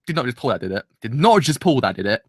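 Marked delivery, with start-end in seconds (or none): none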